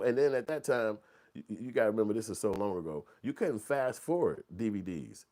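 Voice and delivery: normal talking voice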